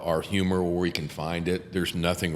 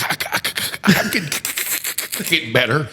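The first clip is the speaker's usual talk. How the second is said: stammering lawyer; 7 semitones higher, much faster than usual. Retold or imitated. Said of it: "the stand-in lawyer's like".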